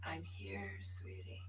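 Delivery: breathy whisper of comfort; tender whisper from Tanya; whispered reassurance. Retold or imitated. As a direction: whisper it lovingly. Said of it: "Tanya would say".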